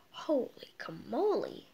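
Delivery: breathless whispered exclamation; awed whisper. Real, not acted. Kid reading about Uranus rings, awed whisper. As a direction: whisper it in amazement.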